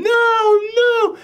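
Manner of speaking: high, loud mock screams; screaming no. Woman screaming as the town's screams echo, loud high cry. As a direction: shriek the nos. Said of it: "she's just screaming".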